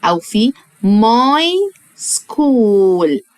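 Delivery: my school said very slowly, skool with no h sound; "school" as skool, h silent; slow model word. Shown as a demonstration. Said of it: "سكول فيها حرف ال H ما بنطقهوش".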